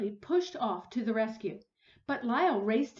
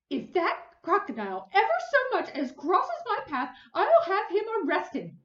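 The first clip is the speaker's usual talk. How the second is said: higher, louder huffy threat; indignant threat; 9 semitones higher, 8 dB louder than usual. Acted. Huffy neighbor calling back her threat while storming off. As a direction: threaten loudly while leaving. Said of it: "she called back over her shoulder".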